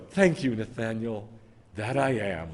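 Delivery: low, soft, humble-sounding thanks; mock gracious acceptance. Preacher voicing what Yeshua would not say, mock gracious. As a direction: softly parody a humble acceptance. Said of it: "I mean, he doesn't go".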